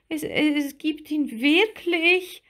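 high, halting and slow, in awe; awed little mermaid, hesitant; timid little mermaid. A narrator's reading. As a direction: stumble on the first word in a small high voice. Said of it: "sagte Lilli leise".

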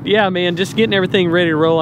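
ordinary delivery

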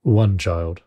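put-on low-pitched old miser's reply; Scrooge. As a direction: answer the spirit in a low character voice. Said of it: "Scrooge returned".

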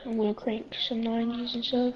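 ordinary delivery

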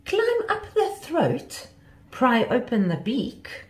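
high, slow, incredulous echo; scoffing. A narrator's reading. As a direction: repeat the plan in scornful disbelief. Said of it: "Sue scoffed at her friend".

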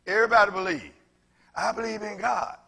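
fired-up preaching shout; rising exhortation. Real, not acted. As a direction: lift it up and call it out.

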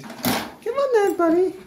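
high squeaky come-on to a pet; coaxing pet talk. Real, not acted. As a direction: coax the ferret sweetly. Coaxing the ferret.

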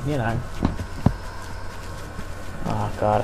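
ordinary delivery

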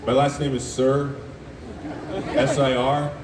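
ordinary talk

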